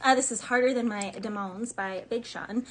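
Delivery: ordinary talk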